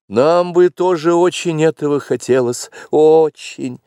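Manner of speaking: put-on high bleating young deer; bleating fawn. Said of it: "проблеял".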